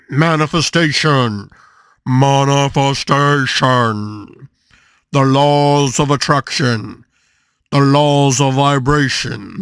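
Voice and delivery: drawn-out, very slow mock-solemn proclamation; mock-grand announcer. Introducing manifestation and its laws to viewers, mock-grand. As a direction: stretch each word like a hammy orator.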